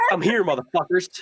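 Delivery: loud, high cocky entrance; The Rock swagger. Retold or imitated. Player hyping the hero's entrance, loud cocky swagger. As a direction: strut in like a wrestling star. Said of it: "You kinda walk in like The Rock".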